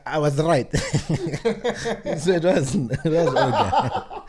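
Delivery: laughing through the words, high. Real, not acted. Recalling being proven right about the word, laughing. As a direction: break into laughter mid-line.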